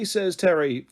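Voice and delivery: usual speaking voice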